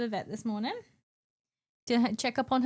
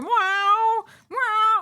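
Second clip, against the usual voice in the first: loud, high, croaky yowls; urgent croaky meows; 10 semitones higher, 8 dB louder than usual. Retold or imitated. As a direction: yowl urgently like a cat in a crate. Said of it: "she started going".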